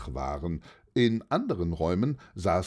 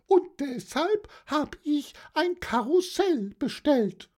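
very high-pitched aunt explaining; high auntie voice, regretful turning eager; old aunt, wistful then hopeful. Acted.

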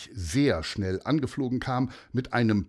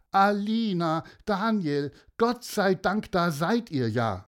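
hoch gerufen, erleichtert; erleichtertes Rufen; 8 semitones higher than usual. Acted. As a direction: ruf die Kinder vor Erleichterung. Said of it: "die Mutter rufen". (Mutter ruft erleichtert nach ihren Kindern)

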